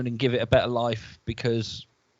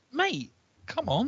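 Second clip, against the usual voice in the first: pleading mate; 11 semitones higher, slower than usual. Retold or imitated. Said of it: "It was like".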